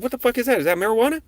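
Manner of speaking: high, rising shocked questions; startled interrogation; alarmed disbelief. Retold or imitated. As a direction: voice shoots up in alarm. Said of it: "I said".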